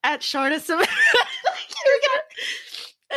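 high, breaking into laughter; laughing. Real, not acted. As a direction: giggle it out. Laughing sign-off.

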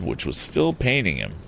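usual speaking voice

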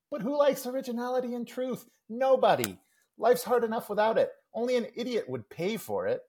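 high-pitched, emphatic mock disdain; scornful comic rant. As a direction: scoff through a cartoon kid's tirade. Acts out Calvin's comic rant.

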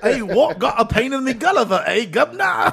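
high, loud mock-British street patter; British accent. Co-host greeting an imaginary guv'nor, hammed-up British accent. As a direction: go big and high as a parody British geezer. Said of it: "British accents".

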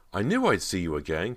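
almost crying with joy at the reunion; voice breaking with emotion. Acted. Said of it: "her voice breaking with emotion".

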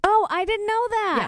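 genuinely taken aback, pitch jumping up; surprised. Real, not acted. Podcaster reacting to news about a restaurant, surprised.

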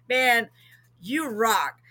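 ordinary delivery

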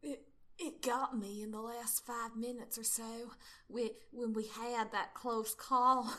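halting, drawn-out bad Southern accent; McCree. McCree confessing his wound, bad Southern accent. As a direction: stretch it out in a put-on Southern accent. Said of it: "do the bad Southern accent".

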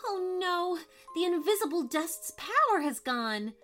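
alarmed cry. A narrator's reading. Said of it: "cried Violet".

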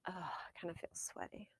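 breathy whisper under her breath; whispered aside. Real, not acted. Admitting to her co-host in a whisper she feels sweaty. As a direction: whisper it to yourself, worn out. Aside after heavy work.

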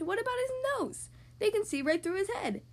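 high, excited exclamation without real volume; storybook shout. A narrator's reading. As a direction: call it out high, as in a children's story. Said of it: "Mister Happy shouted".

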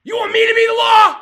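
screamed challenge, high and very loud; furious shout. Real, not acted. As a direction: scream it down the phone. Furious threat shouted on call.

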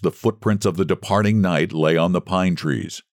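ordinary delivery

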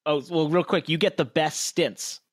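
ordinary talk

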